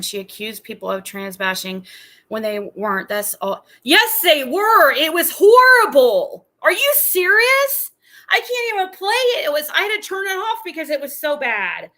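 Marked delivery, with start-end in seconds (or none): 3.8-10.6 much higher and louder, emphatic; heated outburst. Real, not acted. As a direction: shout it down indignantly. Arguing about offensive clip.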